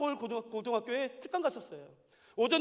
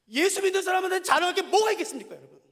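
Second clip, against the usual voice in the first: louder, higher rhetorical question; louder emphatic repeat; 6 semitones higher, 6 dB louder than usual. Real, not acted. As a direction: press the question harder. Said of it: "repeats question louder".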